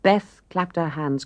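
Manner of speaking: plain reading voice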